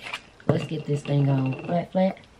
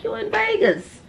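high mock viewer voice; viewers' voice. Imagining viewers gushing about Vegas, high mock squeal. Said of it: "I know y'all like".

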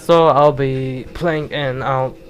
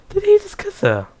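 shocked; 4 dB louder than usual. Real, not acted. Surprised at onscreen kiss.

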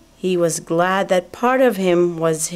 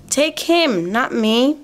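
higher-pitched little apple voice, lightly acted; pleading apple; 6 semitones higher than usual. A narrator's reading. Apples begging the pickers to take someone else, pleading.

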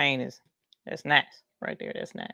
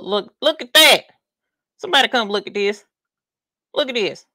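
excited calling out; 5 semitones higher, 5 dB louder than usual. Real, not acted. Showing off a sparkling stone.

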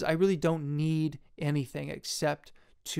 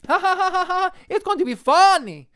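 loud, much higher forced ha-ha-ha; fake laugh; 10 dB louder than usual. Filmmaker mock-laughs about his comedy movie, loud and high. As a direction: ham up a fake belly laugh.

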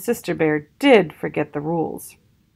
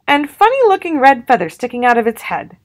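little sister's voice; 6 semitones higher, 4 dB louder, faster than usual. A narrator's reading. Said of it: "said sister as a finishing touch".

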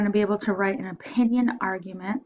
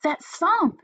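put-on high, loud child's shout; 9 semitones higher, 4 dB louder than usual. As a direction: yell it up to Mom downstairs. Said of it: "he shouted".

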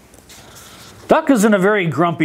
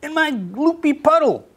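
high, bright pig invitation; a pig calling Duck over to play; cheerful pig voice; 12 semitones higher than usual. A narrator's reading. Pig inviting Duck into the mud, high-pitched. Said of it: "said Pig".

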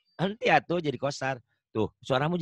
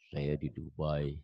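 much lower, softer, slow reply; deep adult answer; 10 semitones lower, 8 dB quieter, slower than usual. Retold or imitated. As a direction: answer low and calm as the grown-up. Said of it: "nanyanya anak". (jawaban orang dewasa, rendah dan pelan)

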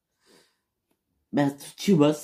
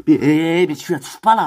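loud protest; 2 semitones higher, 9 dB louder than usual. Acted. Protesting a touch.

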